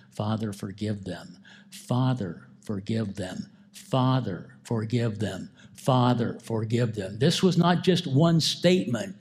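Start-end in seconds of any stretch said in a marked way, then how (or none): 0.1-7.2 hushed plea. Acted. Jesus repeating his plea on the cross.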